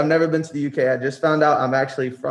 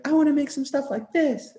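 hint of a different voice for the artist; 13 semitones higher than usual. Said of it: "he's like".

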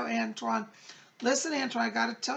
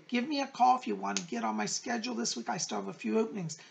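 ordinary talk, nothing marked

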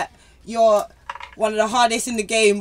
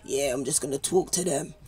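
smooth, low and soft impression; Gigs; 8 semitones lower, 8 dB quieter than usual. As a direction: drop it down low, smooth and quiet. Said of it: "he's got that kinda smooth".

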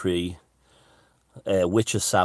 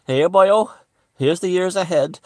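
hammed-up high, loud Welsh accent; chirpy Welsh druid greeting, pitched well up; 12 semitones higher, 8 dB louder than usual. Vlogger playing a Welsh druid giving his proclamation, loud Welsh accent. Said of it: "I should put on a Welsh accent".

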